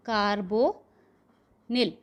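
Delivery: carbonyl stretched out very slowly with a falling end; slow dictation. Shown as a demonstration. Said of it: "तो ये हो गया आपका ... tetra carbonyl". (carbonyl को बहुत धीरे खींचकर बोलना)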